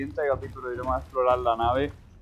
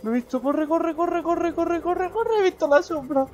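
frantic high panic; being chased; 6 dB louder than usual. Real, not acted. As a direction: gasp it out while fleeing. Fleeing the Reaper.

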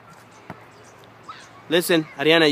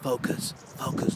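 breathy whisper, softer to settle her; hushed coaching whisper; whispered focus; 4 semitones lower, 12 dB quieter than usual. Real, not acted. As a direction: whisper it close to keep her calm. Coach calming child before shot.

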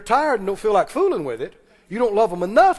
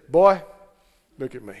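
stern daddy; 6 semitones lower, 4 dB louder than usual. Retold or imitated. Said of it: "he'd look at me and he'd say".

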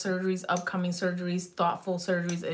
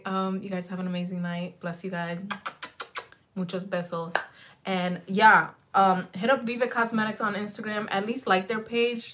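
usual speaking voice

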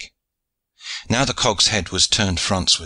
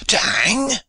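put-on To hang? higher still, hang rising sharply, French accent; outraged French accent; 14 semitones higher than usual. A narrator's reading. French pirate repeating his disbelief, higher and accented. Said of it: "he cried in broken English".

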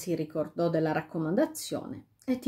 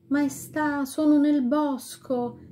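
higher, slow voice from far off; distant voice; 7 semitones higher, slower than usual. A narrator's reading. Serpentina answers the king from far away. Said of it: "una voce lontana, lontana".

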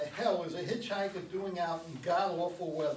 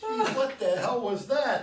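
jumpy and louder, caught off guard; startled; 5 semitones higher, 8 dB louder than usual. Acted. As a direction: react like you just got spooked. Dan startled by the whoop.

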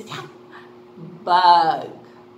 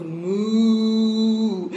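long, low drawn-out moo; cow mooing; 3 semitones lower, 4 dB louder than usual. Shown as a demonstration. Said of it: "just like a cow goes".

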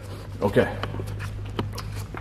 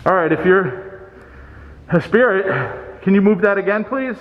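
raised pitch, deliberate polite request; calling to a spirit; 6 semitones higher than usual. Real, not acted. Ghost hunter asks a spirit to move something, calling out. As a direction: call out and ask the unseen to act.

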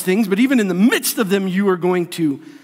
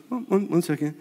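soft, low, unbothered aside; nonchalant stalling; 3 semitones lower, 7 dB quieter, faster than usual. Pretend. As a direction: quietly put them off mid-meal. Unbothered diner stalls his attackers.

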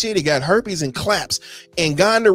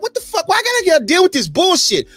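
exasperated outburst; 9 semitones higher than usual. Retold or imitated. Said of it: "I'm like".

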